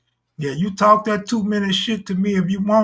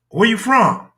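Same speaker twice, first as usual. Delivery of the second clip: higher, louder demanding challenge; gang challenge; 5 semitones higher, 4 dB louder than usual. Retold or imitated. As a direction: bark the question at a stranger. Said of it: "South Los will pull up".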